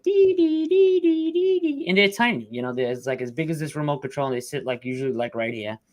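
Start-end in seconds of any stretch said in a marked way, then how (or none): 0.0-1.9 much higher, louder mock electronic beeps; imitated alarm beep. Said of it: "it beeps, like".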